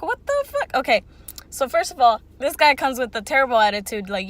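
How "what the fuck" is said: high-pitched incredulous reaction, retold; baffled disbelief. Customer reacting to the rude man beside her, incredulous. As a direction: squeak it out in mock disbelief, much higher.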